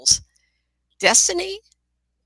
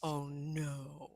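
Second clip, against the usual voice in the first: soft, low "oh, no"; retold inner dread, softly; dismayed thought; 4 semitones lower, 15 dB quieter than usual. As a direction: think it to yourself, hushed and sinking. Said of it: "And I thought".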